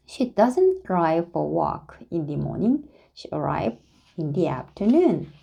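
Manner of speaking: ordinary delivery, nothing marked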